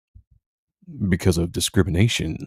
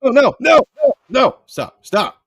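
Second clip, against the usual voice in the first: loud, high panicked "no, no, stop"; panicked shouting; 9 dB louder than usual. Real, not acted. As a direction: cut it off in a real panic. Panic at wrong video audio.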